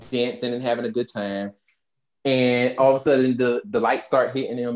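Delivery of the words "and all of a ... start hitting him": ordinary delivery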